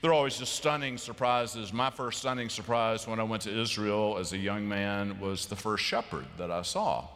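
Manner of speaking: normal talking voice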